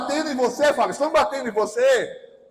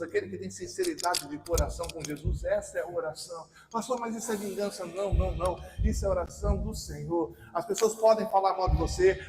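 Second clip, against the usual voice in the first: low, fast, softer preaching patter; preacher's rapid exposition; 8 semitones lower, 8 dB quieter than usual. Real, not acted. Preacher explaining a prayer to the congregation, low and quick. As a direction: run through it quickly, low and restrained.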